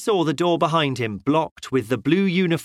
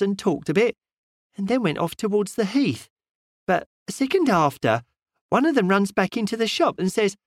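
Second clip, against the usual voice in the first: lightly voiced chatty shopwoman, higher; a hint of a village shopwoman, pitched up; doubtful shopwoman; 6 semitones higher than usual. A narrator's reading. Shopwoman explaining to the detective, lightly voiced higher. Woman shopkeeper telling the story.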